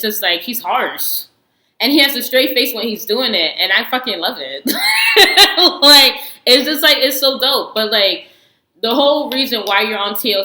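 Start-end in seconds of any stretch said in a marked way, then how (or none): 4.7-5.8 sudden squeal of a laugh, much higher; high burst of laughter. Real, not acted. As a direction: break into a high, loud laugh.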